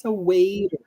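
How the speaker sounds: ordinary talk, nothing marked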